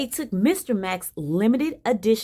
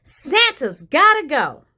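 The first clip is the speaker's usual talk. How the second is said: slow, loud, high outburst; erupting shout; 7 semitones higher, 8 dB louder, slower than usual. Acted. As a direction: shout it like a fed-up little girl, drawing it out. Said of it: "and shouted".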